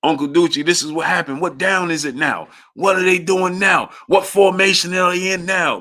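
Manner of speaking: put-on old uncle begging to know the formation; imitating blind uncle. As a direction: badger for every play.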